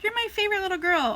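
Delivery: high, fast syrupy coo; doting parent voice. Acted. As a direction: gush over the little girl. Parent flattering child.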